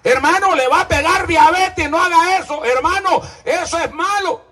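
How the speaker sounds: mock warning.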